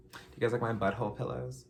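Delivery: usual speaking voice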